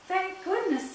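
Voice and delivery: high, relieved exclamation; jolly. A narrator's reading. Santa relieved at the glow in the fog, jolly. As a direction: burst out jolly, pitch jumping right up. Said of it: "suddenly jolly again".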